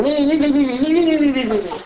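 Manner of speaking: high, nagging me-me-me chant; whiny complainer. Retold or imitated. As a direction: parody of a complainer going on and on. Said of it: "And now you're doing".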